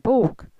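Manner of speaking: "bok" loud and high, as round a large gumball; hollow Swedish vowel. Shown as a demonstration. Speaker finally satisfying host brother, hollow gumball "bok". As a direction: open the inside of your mouth wide on "bok". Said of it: "opened the inside of my mouth, as if I had a large gumball".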